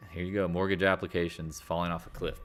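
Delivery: ordinary delivery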